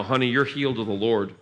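ordinary talk, nothing marked

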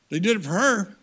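normal talking voice

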